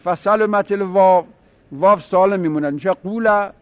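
ordinary talk

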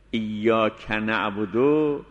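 ایاک نعبدو drawn out, very slow; very slow recitation. Shown as a demonstration. Teacher modelling recitation for students, very slow. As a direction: savour each syllable of ایاک نعبدو. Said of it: "بعد از ایاک و قبل از نعبدو فاصله".

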